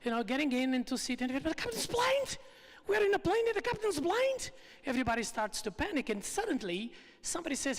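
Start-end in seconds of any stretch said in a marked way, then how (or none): 1.5-4.5 panicked passengers. Retold or imitated. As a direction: shriek it like terrified passengers.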